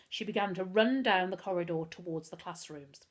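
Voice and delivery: usual speaking voice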